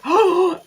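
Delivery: sharp, loud mock gasp; shocked gasp. Everyone hears Lisa accused of the leak, mock shocked gasp. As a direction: gasp as if scandalised.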